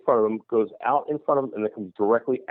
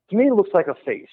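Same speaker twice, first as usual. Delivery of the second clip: ordinary delivery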